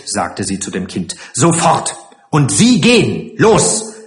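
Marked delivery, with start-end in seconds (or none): 1.3-3.9 raised, abrupt orders; sharp commands. Acted. Matron sends the strangers away, sharp and imperious. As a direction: order them off, climbing in pitch.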